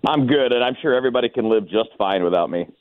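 ordinary talk

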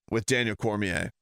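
ordinary delivery

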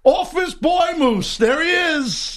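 high teasing jab at his partner; mocking. Real, not acted. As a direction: razz him like a corporate suit.

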